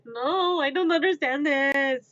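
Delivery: high, loud helpless protest; hammed-up cry of total confusion; mock despairing wail. Same acted protest in English.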